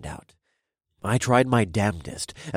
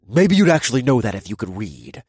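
growling; 3 semitones higher, 8 dB louder than usual. Acted. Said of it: "the deep voice growled".

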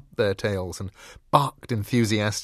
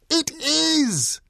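very high, loud cheer; squeaky, drawn-out yell; shouting little dogs; 6 dB louder than usual. A narrator's reading. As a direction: shout back as a small-dog audience. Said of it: "shouted all the little dogs".

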